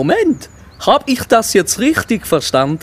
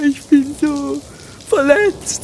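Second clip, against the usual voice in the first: sobbing. Acted. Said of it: "Der Kürbis schluchzte mehrere Stunden lang".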